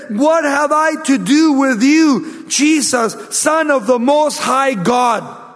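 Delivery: high, drawn-out preacher's cry; loud crying out. Acted. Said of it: "he cried out with a loud voice".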